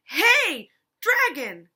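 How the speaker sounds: shout. Acted. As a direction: yell as loud as you can into his ear. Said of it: "She shouted as loud as she could".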